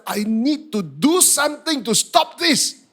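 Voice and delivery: slow, high, loud angry determination; worked-up vow to oneself; angry resolve. Retold or imitated. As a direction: punch it out, fed up and fuming. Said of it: "I was getting more and more angry".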